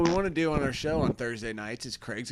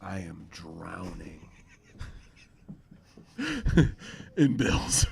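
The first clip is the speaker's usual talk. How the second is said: low, hushed, deliberate confession; low and soft, drawing out the last words; weary video store clerk in a scene; 8 semitones lower, 7 dB quieter than usual. Acted. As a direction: play a clerk quietly confiding his troubles.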